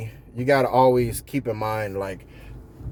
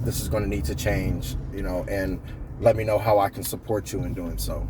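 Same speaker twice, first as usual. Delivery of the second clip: measured, lower, softer and steady; calm professional; 6 semitones lower, 4 dB quieter than usual. Shown as a demonstration. Supervisor calmly giving a worker clear feedback. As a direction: talk to him calmly and professionally. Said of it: "See, that's how you do it".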